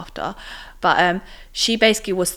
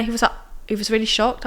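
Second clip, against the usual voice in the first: usual speaking voice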